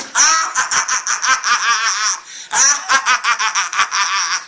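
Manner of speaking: big loud burst of laughter. Real, not acted. Laughing loudly at his own joke for the viewers. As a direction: laugh hard at the punchline.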